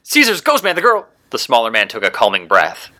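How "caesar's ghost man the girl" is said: high-pitched, exasperated exclamation; indignant outburst. A narrator's reading. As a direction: burst out high, appalled at the hero. Villain slams hero into wall, indignant.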